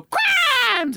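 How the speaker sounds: very high, loud shout; touchdown-cheer falsetto=; exultant inflection. Shown as a demonstration. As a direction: whoop it as if arms shoot up. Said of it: "hoping that my voice inflection shows this".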